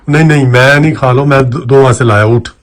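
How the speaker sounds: loud, pushy wake-up calls; loud insisting. Acted. Man loudly urging the sleeping woman to eat. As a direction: push her to get up, loudly.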